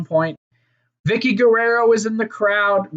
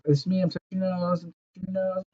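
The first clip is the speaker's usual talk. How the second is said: a hint of playful character, softer; lightly voiced wrestler; 8 dB quieter, faster than usual. Podcaster imitating a wrestler's nose-touching gimmick, lightly voiced. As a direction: murmur it as a little comedy bit. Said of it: "the touching the nose".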